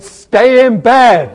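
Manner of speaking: loud, drawn-out heckle, pitched high; imitated bold shout. Teacher mimicking someone in class who boldly shouted out, loud shout. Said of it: "somebody shouted".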